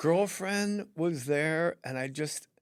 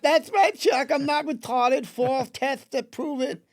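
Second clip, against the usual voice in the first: much higher squeaky contestant voice; 12 semitones higher, 6 dB louder, slower than usual. Retold or imitated. Contestant answering the host, high silly voice. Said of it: "game show called I Don't Fucking Believe It".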